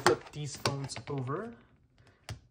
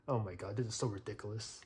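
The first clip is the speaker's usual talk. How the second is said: normal talking voice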